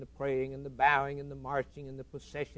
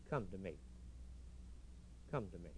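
low and much softer, intimate; tender invitation; 7 semitones lower, 17 dB quieter, faster than usual. Acted. Christ softly inviting the thirsty to come. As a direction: call them in gently, almost a hush.

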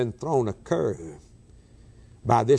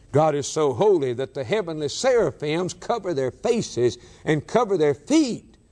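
ordinary delivery

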